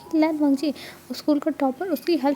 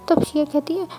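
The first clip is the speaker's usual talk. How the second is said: ordinary delivery, nothing marked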